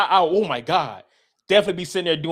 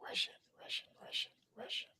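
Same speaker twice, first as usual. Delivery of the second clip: very soft whisper to oneself; whispered muttering; 22 dB quieter than usual. Real, not acted. Getting ready for a Russian accent, whispered. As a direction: whisper it like a warm-up.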